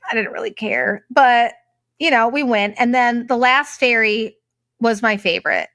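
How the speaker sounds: normal talking voice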